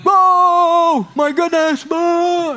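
loud, high-pitched doggy joy; hammed-up ecstatic greeting; overexcited dog. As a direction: howl it out like a dog thrilled at its owner's return. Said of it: "the dog's like".

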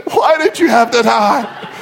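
hammed-up tearful wail; exaggerated blubbering lament; mock sobbing. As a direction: cry it out for comic effect. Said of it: "he's weeping and he's sobbing".

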